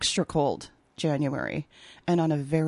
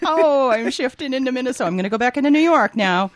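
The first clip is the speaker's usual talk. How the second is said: hammed-up Minnesota accent, louder and higher; 8 semitones higher, 9 dB louder than usual. Guest showing off her Minnesota accent to the host. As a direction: lean into a playful Minnesota accent. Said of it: "Here comes my Minnesota accent".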